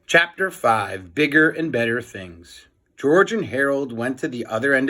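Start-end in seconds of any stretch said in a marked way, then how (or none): none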